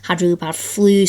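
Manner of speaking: ordinary delivery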